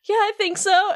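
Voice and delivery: high, lightly voiced relaxed Sora; mock Sora. Friend retells Sora leaning on the door, high mock voice.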